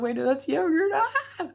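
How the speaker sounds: high, loud, laughing realisation ending in a squeal; giddy squeal of relief, way up high. Retold or imitated. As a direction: laugh it out with a shriek at the end. Relief at realising it's yogurt.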